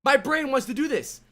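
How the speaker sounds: high, excited silly voice for the fingers; voicing the fingers. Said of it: "Your fingers go".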